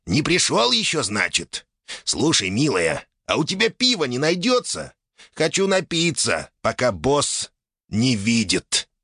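a hint of a cheeky youngster; much younger voice. A narrator's reading. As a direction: wheedle for a drink in a younger voice. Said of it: "вдруг произнёс куда более юным голосом".

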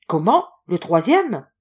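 astonished question. A narrator's reading. Friend astonished by the news.

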